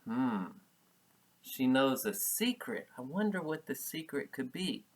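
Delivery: ordinary delivery, nothing marked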